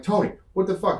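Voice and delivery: high-pitched exasperated demand; retold pissed-off challenge. Wrestler confronting his opponent the next day, pissed off. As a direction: confront him, pitch jumping up. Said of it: "And I was so pissed".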